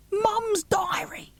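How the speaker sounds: high, hushed whisper of wonder; amazed whisper. Acted. Child whispering in awe about his mum's diary. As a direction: breathe it out in disbelief.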